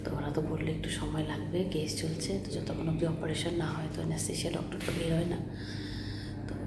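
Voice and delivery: hushed hospital whisper. Real, not acted. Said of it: "আমি খুব আস্তে আস্তে কথা বলছি".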